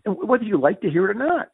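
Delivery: high-pitched, animated retelling of an argument; animated retort. Retold or imitated. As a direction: argue back insistently, pitched well up. Acting out heated meeting argument.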